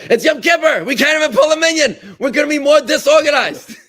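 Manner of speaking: high, loud comeback with a laugh breaking in; mock-exasperated retort. Campus rabbi retorts to the atheist professor, loud and laughing. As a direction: fire back at the professor, half laughing. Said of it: "So the rabbi says".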